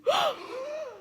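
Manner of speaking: sharp high gasp with a wide-open mouth; open-mouthed warm-up gasp; big shocked gasp. Shown as a demonstration. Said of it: "Do that again".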